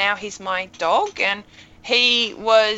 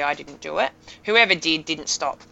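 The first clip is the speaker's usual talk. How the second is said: normal talking voice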